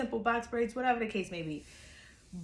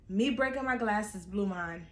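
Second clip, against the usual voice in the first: usual speaking voice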